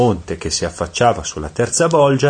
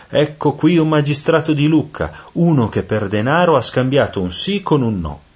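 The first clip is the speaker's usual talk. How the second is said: high-pitched announcement, crying it out; shouting; 8 semitones higher, 3 dB louder than usual. A narrator's reading. Devil presents a corrupt magistrate to demons, shouting. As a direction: proclaim the new catch in a raised voice.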